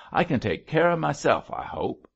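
indignant retort starting high, dropping at the end; flashing out. A narrator's reading. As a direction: stand up for yourself with a flare of temper. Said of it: "flashed out Belle".

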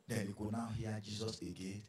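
ordinary talk

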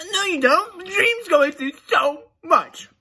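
high, loud whiny sarcastic pleading; mock whiny defender. Mocking a fan defending Dream in a high whiny voice. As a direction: whine it like a hurt fanboy.

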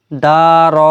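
দা and র each stretched long; wrongly drawn out. Shown as a demonstration. Teacher shows what is not allowed, drawn-out syllables. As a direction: drag out both vowels in দা র. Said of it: "এরকম পড়া যাবে না".

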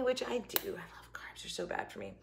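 whispered confession. Real, not acted. Confiding to viewers about carbs, whispered. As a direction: breathe it like a secret.

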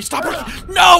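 rising to a high, loud no; panicked shout. Real, not acted. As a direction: yell in alarm. Building panic at character.

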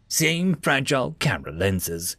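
ordinary delivery, nothing marked

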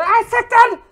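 high, loud startled swear; sudden loud outburst; shocked curse. Real, not acted. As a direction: blurt it out in shock. Shocked curse reading chat message.

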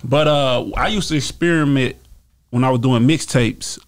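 usual speaking voice

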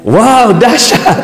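amused mock awe; mock amazement. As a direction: cheer the bad news as if it were great. Preacher's mock praise.

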